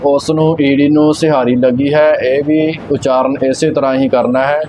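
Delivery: ordinary talk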